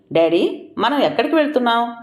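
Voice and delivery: puzzled son's question, quick and lifted; a touch of astonishment, hurried; surprised. A narrator's reading. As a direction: ask dad in mild surprise, pitch rising. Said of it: "అన్నాడు ఆశ్చర్యంగా".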